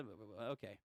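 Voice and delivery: ordinary delivery, nothing marked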